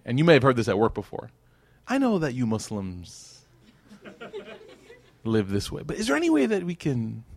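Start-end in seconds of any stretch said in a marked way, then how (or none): 1.9-7.2 hesitant coaxing coworker. Retold or imitated. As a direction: imitate a coworker gingerly asking for compromise. Voices a coworker's awkward request.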